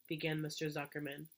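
ordinary delivery

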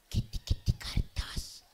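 breathy secret whisper, slow; whispered girlfriend voice. Acted. Girlfriend whispering to her lover at night, slow whisper. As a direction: whisper like a girlfriend hiding under the sheet.

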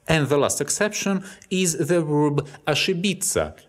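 ordinary delivery

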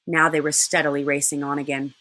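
plain reading voice

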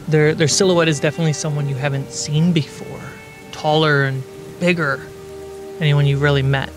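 usual speaking voice

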